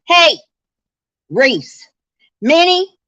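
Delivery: sharp, high attention-getting call. Real, not acted. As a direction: call loudly to the dog. Calling out to the dog.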